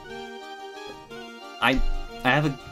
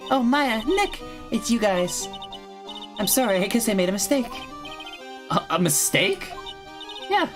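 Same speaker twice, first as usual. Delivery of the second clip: put-on high, sweet girlish voice; bright innocent animal tamer girl; cheerful young girl voice; 9 semitones higher than usual.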